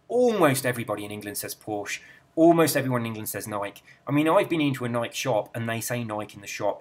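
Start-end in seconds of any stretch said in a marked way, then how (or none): none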